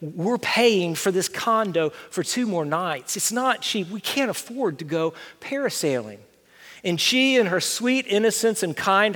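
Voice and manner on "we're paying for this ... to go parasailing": hushed aside to wife. Retold or imitated. Husband quietly pleading with his wife about cost, hushed. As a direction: murmur it so the kids can't hear. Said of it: "pulled my wife aside and said".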